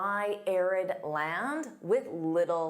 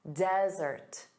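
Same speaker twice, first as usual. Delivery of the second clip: ordinary talk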